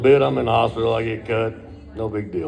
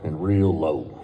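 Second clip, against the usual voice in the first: slow, low drawl; retelling his own slow low voice; 9 semitones lower, much slower than usual. Retold or imitated. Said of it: "I talked real slow and real low".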